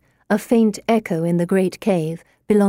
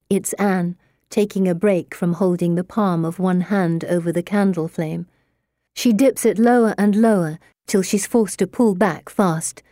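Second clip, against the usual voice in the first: ordinary delivery, nothing marked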